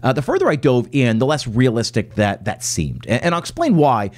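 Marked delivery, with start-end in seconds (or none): none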